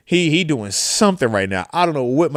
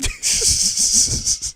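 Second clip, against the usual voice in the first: breathy laugh; 5 dB quieter than usual. Real, not acted. Laughs after the story.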